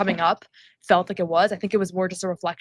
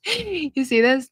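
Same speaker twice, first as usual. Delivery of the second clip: laughing; 5 semitones higher, much slower than usual. Real, not acted. Laughing at a comment.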